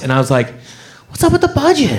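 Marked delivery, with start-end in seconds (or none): 1.1-2.0 high-pitched, put-on inquiry; mock-earnest question. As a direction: ask it in a high, pleading pitch. Punchline question to the callers.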